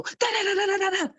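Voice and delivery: high sing-song mocking da da; mocking babble. To men who tune women out, high mocking da da. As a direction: mimic nagging as meaningless babble. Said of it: "get us to go".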